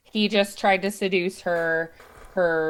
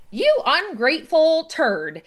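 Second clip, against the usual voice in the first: higher, louder, playfully furious; mock angry insult; 10 semitones higher, 6 dB louder than usual. Zerlina turning on her jealous fiance in mock anger. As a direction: jab the insult hard, half in fun. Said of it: "she ends up getting mad at him".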